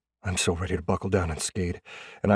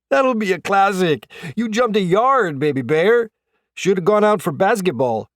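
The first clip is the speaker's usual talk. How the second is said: put-on loud, high-pitched Wisconsin accent; big Wisconsin accent; 12 semitones higher, 7 dB louder than usual. A narrator's reading. Rookie teases the pranked player over his jump, big Wisconsin accent. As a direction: crow loudly in a broad Wisconsin accent. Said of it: "in his big Wisconsin accent".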